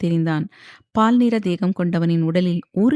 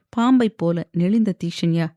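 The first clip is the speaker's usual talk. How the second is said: ordinary delivery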